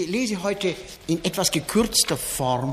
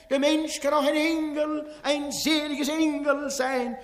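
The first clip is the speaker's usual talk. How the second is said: Wenzel Wondrak, fairground barker; 3 semitones higher than usual. Acted. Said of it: "steht der Ausrufer Wenzel Wondrak".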